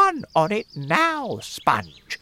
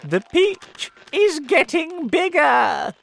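amazed aunt; 9 dB louder than usual. Acted. Aunt marvels at peach.

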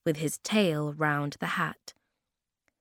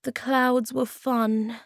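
sadly; 7 semitones higher, 3 dB louder, slower than usual. Acted. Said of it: "said Moomin Troll sadly".

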